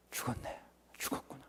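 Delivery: breathy, unvoiced realization of death; stunned whisper. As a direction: whisper it, as if it just sank in. 현실감에 무너지는 속마음. (숨죽인 속삭임)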